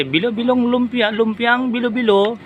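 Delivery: high, slow sing-song call; vendor's call as misheard. Retold or imitated. Retelling the misheard vendor call, high and slow. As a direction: drawl out the vendor's cry. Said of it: "Ang dinig ko kasi".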